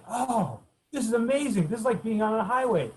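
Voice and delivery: thrilled to find open ground; delighted. Retold or imitated. Delighted at easy climb.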